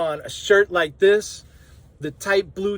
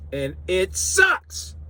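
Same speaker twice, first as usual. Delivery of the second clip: drawn-out disgust; 3 semitones higher, much slower than usual. Real, not acted. Disgust at western gumbo.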